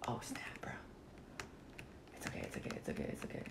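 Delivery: soft hiding whisper. Real, not acted. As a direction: whisper to stay hidden. Hiding from killer.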